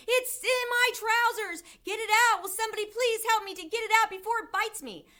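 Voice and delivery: high, loud frantic screaming, speeding up; panicked shouting. A narrator's reading. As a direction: scream for help in rising desperation. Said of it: "stupid cook still shouting".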